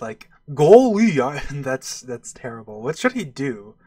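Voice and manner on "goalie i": goofy voice. Shown as a demonstration. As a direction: put on a loud goofy voice. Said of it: "like, a goofy voice".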